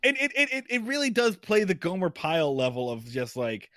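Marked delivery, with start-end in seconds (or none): none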